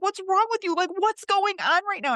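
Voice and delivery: high, distressed questioning; retold tearful protest. Hurt woman repeatedly questioning the man who pushed her, high retold tearful protest. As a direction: protest in a high voice, reliving the upset. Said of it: "I just kept saying that".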